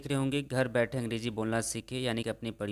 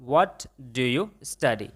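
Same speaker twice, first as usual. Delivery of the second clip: very slow, word by word; slow model sentence; much slower than usual. Shown as a demonstration. Teacher shows students the English, very slowly. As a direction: spell it out slowly for learners. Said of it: "कि आप क्या पढ़ते हैं".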